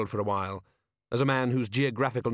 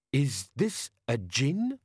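slow desert-man voice; Jabbar; 3 semitones higher, much slower than usual. A narrator's reading. Desert man held up by a troll, slow questioning voice. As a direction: draw the question out, in character.

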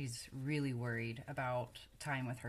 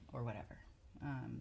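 ordinary talk

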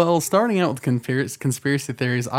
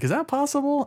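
much higher-pitched questioning voice; retold wondering; 12 semitones higher, 4 dB quieter than usual. Guest voices own thoughts on conspiracy theories, high wondering question. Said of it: "like, thinking".

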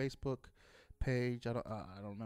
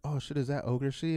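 louder, mock-startled question; retold astonishment; 2 semitones higher, 9 dB louder than usual. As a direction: blurt it out like stunned buddies. Retold buddies recognising the face.

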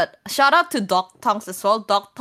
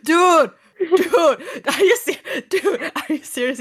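high, laughing disbelief; giggly hammed-up astonishment; mock shock; 7 semitones higher, slower than usual. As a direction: protest incredulously through giggles. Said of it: "I was actually shocked".

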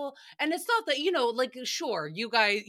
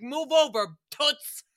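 louder, sassy shove-aside line; sassy command; 5 semitones higher, 6 dB louder than usual. Retold or imitated. Flier barging into first class, sassy loud command. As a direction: barge in with attitude. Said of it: "I'm like".

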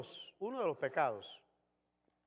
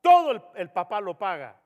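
exasperated father; 2 semitones higher, 8 dB louder than usual. Retold or imitated. Father lists what he gives.

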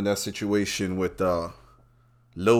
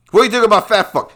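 quick mock interviewer; 9 semitones higher, 6 dB louder, faster than usual. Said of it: "acted interviewer question".

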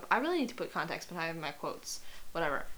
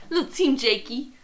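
playful cheer; 5 semitones higher, 6 dB louder than usual. Real, not acted. Playful admission.

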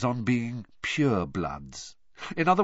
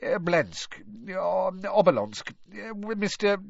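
high, fumbling over names; bumbling official, unsure of names; Fudge voice; 8 semitones higher than usual. A narrator's reading.

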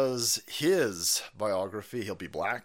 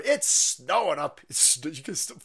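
ordinary talk, nothing marked

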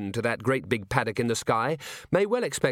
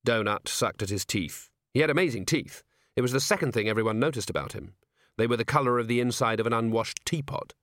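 plain reading voice

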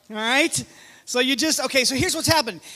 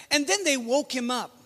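ordinary talk

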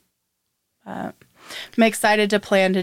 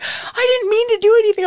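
quoted in a much higher, quicker voice; friend's reply; 4 dB louder, faster than usual. Retold or imitated. Retelling friend's apology, high and quick. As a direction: mimic her protest, pitched way up and hurried. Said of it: "And then she was like".

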